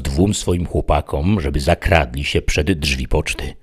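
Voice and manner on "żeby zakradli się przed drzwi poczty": ordinary delivery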